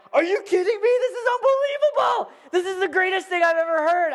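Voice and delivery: high, loud, hammed-up child excitement; acting out a thrilled little boy; overexcited kid. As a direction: squeal it in disbelief. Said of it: "I'm getting so excited about this thing".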